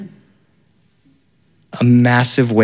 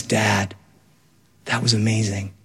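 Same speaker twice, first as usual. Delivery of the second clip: put-on soft child awe; 5 semitones lower, 7 dB quieter than usual. Son speaks softly in dad's arms.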